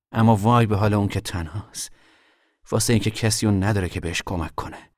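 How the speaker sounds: loud preacher-like recitation; reciting scripture. Retold or imitated. Son reciting the preacher's Bible words to mother, declaiming. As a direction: declaim it like a sermon. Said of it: "اینجوری بود".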